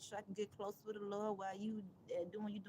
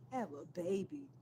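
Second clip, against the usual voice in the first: quoting her advice; 4 semitones lower than usual. Retold or imitated. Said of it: "That what she said to me".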